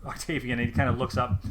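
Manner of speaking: ordinary delivery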